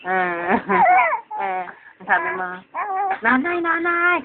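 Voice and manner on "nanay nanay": lightly voiced toddler calling nanay, a bit higher; a hint of a small child's call; child calling mother. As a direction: mildly imitate a little one calling for mom. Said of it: "ka mo".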